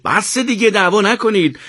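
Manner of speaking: impatient. A narrator's reading. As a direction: break up the squabble impatiently.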